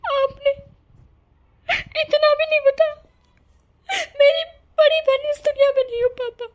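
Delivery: high, breaking sobs through the words; sobbing daughter. Acted. Daughter sobbing to her father over her dead sister. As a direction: cry it out, voice cracking high. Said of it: "तू रो मत".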